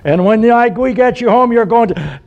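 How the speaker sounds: put-on high, heated scolding of the kids; mock angry threat. Father's threat, cut off by onlooker.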